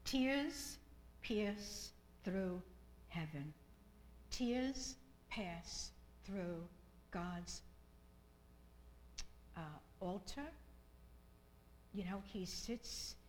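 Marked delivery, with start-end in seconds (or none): 0.0-10.5 very slow, drawn out words; slow emphasis. Real, not acted. Preacher stressing tears reaching God, slowly. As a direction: stretch each word for weight.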